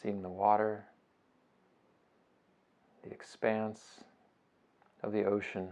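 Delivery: soft, unhurried meditation voice with long pauses; guided meditation. Acted. Teacher guiding listeners through a beach breathing meditation, soft and unhurried. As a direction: ease them gently through each breath, phrase by phrase.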